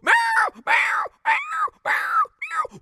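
very high, loud shouted meows; screeching cat. Acted. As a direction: yowl at the dog. Cat fighting the dog.

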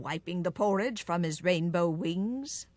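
usual speaking voice

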